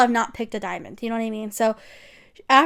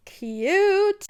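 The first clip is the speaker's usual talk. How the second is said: gushing high cute; 9 semitones higher than usual. Real, not acted. Gushing over Simon.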